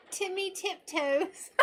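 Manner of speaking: high, slow, giggling over Timmy Tiptoes; laughing. Real, not acted. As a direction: savour the silly name. Laughing at the nickname.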